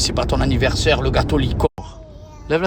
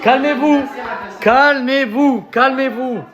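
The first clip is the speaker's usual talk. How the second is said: laughing plea; 8 semitones higher, slower than usual. Real, not acted. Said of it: "Que des fous dans ma famille".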